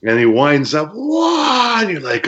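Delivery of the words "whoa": much higher stretched whoa; imitating the opponent winding up; wind-up yell. Wrestler mimicking opponent's kick wind-up, high yell.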